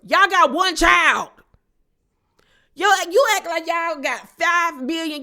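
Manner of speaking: fed-up shout at the screen; incredulous shout. Real, not acted. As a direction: shout it in disbelief at her excuse. Ranting at Angie's excuses.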